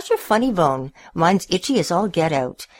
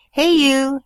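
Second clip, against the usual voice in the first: bright higher eager call; dog talk; 10 semitones higher, 5 dB louder than usual. A narrator's reading. Stanley coaxing the cat under the couch, eager call. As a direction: call out to win a friend. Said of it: "he said it in dog talk".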